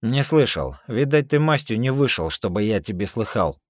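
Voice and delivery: loud, high con talking down; russian prison slang. Acted. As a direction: sneer it like a seasoned inmate. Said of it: "перейти на нормальный язык".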